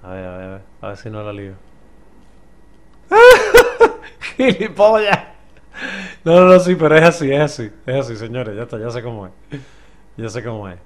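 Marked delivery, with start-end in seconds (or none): 1.6-4.3 high, loud burst of laughter; loud shrieking laugh. Real, not acted. Wild laugh at the game. 4.4-5.2 high, loud insult yelled; angry shout. Real, not acted. Insulting the game loudly. 6.2-8.2 loud, high, building to triumph; excited shouting. Real, not acted.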